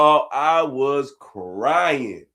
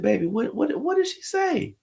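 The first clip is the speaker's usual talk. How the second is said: eager dad asking; 10 semitones higher than usual. Retold or imitated. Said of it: "I said".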